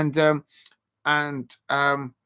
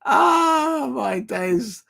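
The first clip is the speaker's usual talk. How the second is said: much higher, louder squeal on ah, then settling; mock squeal; 8 semitones higher, 3 dB louder, much slower than usual. Teasing a viewer about whiskers, high mock squeal. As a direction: squeal it out in delight. Exclaiming over a viewer's picture.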